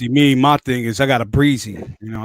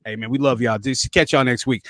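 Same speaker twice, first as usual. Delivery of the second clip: ordinary talk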